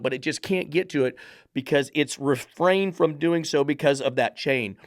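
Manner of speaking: ordinary talk, nothing marked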